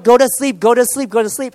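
mock a desperate parent, insisting over and over; exasperated parent. Frustrated parent to a sleepless baby, mock exasperated pleading.

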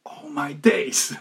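drawn-out mock cry of amazement; retold amazed shout. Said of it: "and he shouted".

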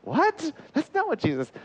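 high-pitched mock disbelief; John taken aback. John correcting a popular myth, taken aback. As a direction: protest in a high, baffled voice. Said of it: "And he's like".

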